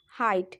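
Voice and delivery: हाइट with the GH left silent, falling. Shown as a demonstration. Teacher showing students how height is read, silent GH. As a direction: say हाइट with no G sound, just 'hite'. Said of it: "तो यहाँ पे हमने इसका pronounce".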